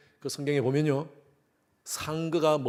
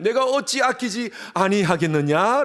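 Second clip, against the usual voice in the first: slow, ringing divine question, higher and louder; God asking his people, slow and higher; God's question; 7 semitones higher, 6 dB louder, slower than usual. Acted.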